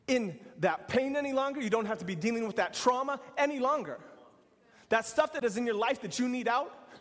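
rally the men to rise up; preaching at full force. Acted.

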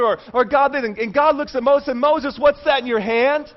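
high, driving sermon delivery; preaching. Real, not acted. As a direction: preach it with urgency, piling up phrases. Preaches with force.

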